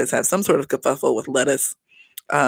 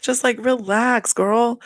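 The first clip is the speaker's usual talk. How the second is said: exasperated advice; 7 semitones higher, slower than usual. Acted. Telling Mia to relax.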